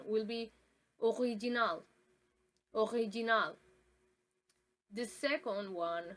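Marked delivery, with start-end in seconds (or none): 1.0-3.6 original said the French way, stress on the last syllable; French pronunciation. Shown as a demonstration. Quiz item for the class, French sounds. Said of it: "the first one is French".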